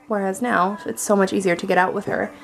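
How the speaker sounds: ordinary delivery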